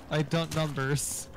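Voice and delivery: drawn-out mocking repeat; slow teasing echo. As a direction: drag the phrase out slowly, teasing. Echoing the odd phrase, slowed.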